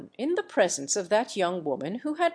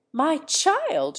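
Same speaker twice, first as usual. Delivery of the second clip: startled cry, pitched high; sudden exclamation; 8 semitones higher, 4 dB louder than usual. A narrator's reading. Mother suddenly remembering her child, startled cry. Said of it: "she suddenly exclaimed".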